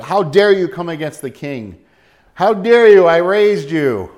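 rising outrage, how dare you; angry father. Pretend. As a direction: play David, indignant at the prince. David imagined scolding his son.

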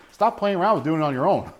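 ordinary talk

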